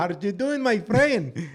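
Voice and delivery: goofy put-on Mexican accent, pitch climbing; thick Mexican accent. Friend joins the bit, mock Mexican accent. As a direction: ham up a broken-English Mexican accent. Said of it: "speak with a, a thick accent".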